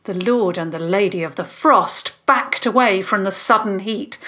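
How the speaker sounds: ordinary delivery, nothing marked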